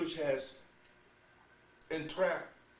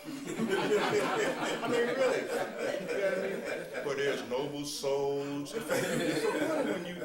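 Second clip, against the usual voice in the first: higher and louder through real laughter; laughing; 6 semitones higher, 8 dB louder, slower than usual. Real, not acted. Teacher laughing to disciples at their folly, higher and louder. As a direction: laugh at the folly while preaching.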